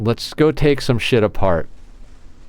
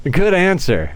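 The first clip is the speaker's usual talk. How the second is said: higher, pleased approval; delighted; 10 semitones higher, 3 dB louder than usual. Real, not acted. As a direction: cheer the reply, high and bright. Reacting to friend's text.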